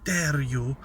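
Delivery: slow, mock-outraged "how dare you"; Greta Thunberg impression. Mocking the activist, slow indignant quote. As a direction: drag it out like a scolding activist. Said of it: "Greta Thunberg".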